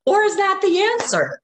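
high, lilting sing-song refusal to argue; playful. Retold or imitated. Retold reply to arguing lunch companion, playful. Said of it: "like, playful".

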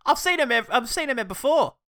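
high, loud mock alarm; hammed-up high-pitched recognition; alarmed witness. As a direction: cry out like a scandalised onlooker. Acts out bystander spotting the man.

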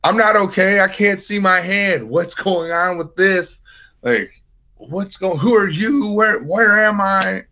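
high, frantic and disoriented, retelling the panic; panicked confusion. Retold or imitated. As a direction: replay the scared, confused wake-up, voice up high. Panicked after waking in ward.

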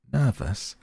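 "Nervous" pitched high, then falling; amused disbelief. A narrator's reading. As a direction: scoff at the very idea of "Nervous". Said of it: "as he laughed".

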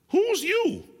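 high, dismissive street voice; street slang. Pretend. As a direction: brush the devil off with attitude.

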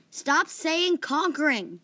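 annoyed. A narrator's reading. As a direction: stretch each word in annoyance.

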